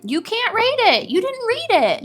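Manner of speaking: high, loud and outraged; real indignant outburst at unread ratings; indignant exclaim. Real, not acted. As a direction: pitch it way up in protest.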